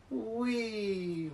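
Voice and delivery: high playful whee; excited whee. Acted. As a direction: sing out a gleeful whee. Card flip exclamation.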